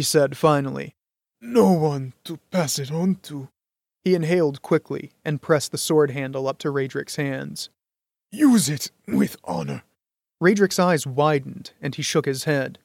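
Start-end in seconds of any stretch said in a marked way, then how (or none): 1.4-9.8 dying man. A narrator's reading. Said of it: "his breath came in shallow rattles".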